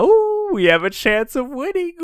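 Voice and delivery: high, loud, gleeful mock cheer; mock excited Killer. Killer seeing a chance to win, hammed-up excitement. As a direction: whoop it way up high. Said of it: "And then Kill is like".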